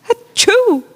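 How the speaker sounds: high sharp mock sneeze. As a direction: fake a loud sneeze. Child sneezes.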